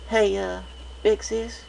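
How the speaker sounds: high little-sister voice, coaxing; a small filly asking big sis a favour; young filly. A narrator's reading. Young filly voice for Apple Bloom.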